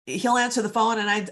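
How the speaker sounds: ordinary talk, nothing marked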